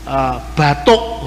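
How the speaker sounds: slow, low Balinese batuk; Balinese accent. Shown as a demonstration. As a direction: batuk in a drawn-out Bali style. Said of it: "mesti batuk". (logat Bali yang lambat, batuk)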